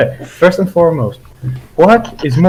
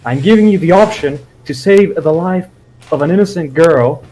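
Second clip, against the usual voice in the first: ordinary talk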